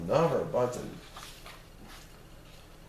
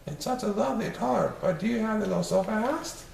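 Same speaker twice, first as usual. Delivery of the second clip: high lady's voice; a worried wife asking gently; mother's voice; 8 semitones higher than usual. Acted. Missus Popsnuggle speaks.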